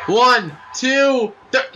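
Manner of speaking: excited count. Real, not acted. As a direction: shout the pin count. Counting a pin live.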